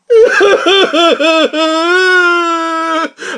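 laughing, close to sobbing; loud half-crying laugh; exasperated laughing. Real, not acted. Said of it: "I'm a little dramatic".

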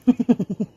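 short high villain cackle; evil cackle. Acted. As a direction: cackle at the fear.

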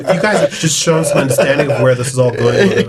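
playful put-on American accent. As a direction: keep up the American investor accent through laughter. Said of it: "went into an American accent that way".

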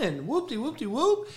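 high, singsong dismissive mimicry; high mock babble; mocking chatter. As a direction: wave the critics off in a high, silly voice. Dismissing his critics' chatter.

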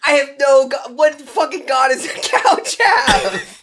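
high, slow laughing protest. Real, not acted. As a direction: giggle through the protest. Couch character answers god question.

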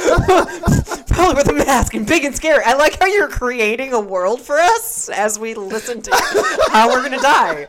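high, loud, laughing delivery; amused teasing while cracking up. Real, not acted. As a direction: barely get it out through laughter. Cracking up at co-host's aside.

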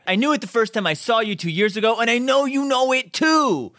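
high, loud, insistent near-yell; desperate, aggressive plea. Retold or imitated. Jess begging her to come away, desperate near-yelling. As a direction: imitate relentless pleading, building to a peak. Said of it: "desperate plea he's got is just".

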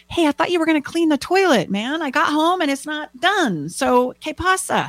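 high-pitched, indignant complaint; exasperated nagging. Acted. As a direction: confront a partner over an undone chore. Acted nagging quarrel over chores.

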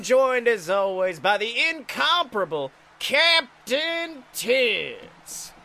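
loud ring announcer hype; wrestling announcer. Acted. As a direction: shout it like a ringside host. Mock wrestling show host.

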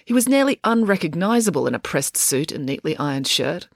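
usual speaking voice

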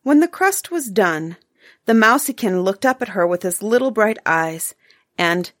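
usual speaking voice

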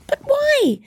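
very high cry; cried. A narrator's reading. Nephew cries out, very high, at his uncle's refusal. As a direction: cry out in disbelief. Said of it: "cried Scrooge's nephew".

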